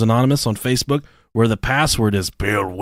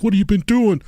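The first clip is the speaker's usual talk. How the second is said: a mildly higher voice for his partner; lightly voiced partner asking what he's been up to; questioning woman; 7 semitones higher than usual. Partner demands to know what he's been doing, lightly raised voice. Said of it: "She's like".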